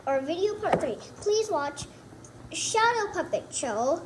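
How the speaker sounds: usual speaking voice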